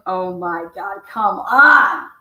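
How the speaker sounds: retold groan to a friend; exasperated. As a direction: plead with mock exasperation.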